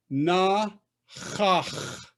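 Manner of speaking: "Na", pause, "chach" rasped at both ends; two throaty chs. Shown as a demonstration. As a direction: sound out "Na ... chach". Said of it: "you got two chs in a row".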